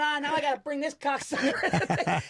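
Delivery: high-pitched imitation of a griping Italian guy. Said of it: "sounded like two fat Italian guys".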